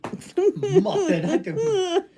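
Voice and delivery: high, loud, squealing through laughter; laughing outrage. Real, not acted. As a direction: pretend to be hurt while laughing. Mock offense, laughing.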